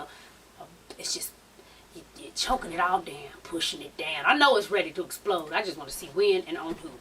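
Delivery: low, hushed and steady; calm quiet voice. Retold or imitated. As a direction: say it softly and coolly. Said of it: "she was real calm".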